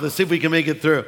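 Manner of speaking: ordinary delivery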